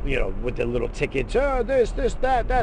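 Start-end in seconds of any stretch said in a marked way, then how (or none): 1.3-2.6 mock stockbroker. Mimicking stockbrokers shouting on floor.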